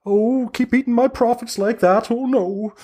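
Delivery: high crone sighing over her losses, then cheerful; an old witch turning brightly to her customers; hag, grumbling then chirpy. Acted. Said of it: "She goes".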